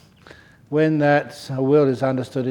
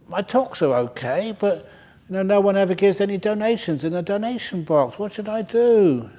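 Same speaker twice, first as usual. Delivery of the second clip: lightly voiced young monk, pitched higher, pleading; worried young monk; 6 semitones higher than usual. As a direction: ask for advice in a higher, anxious young monk's voice. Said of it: "and said".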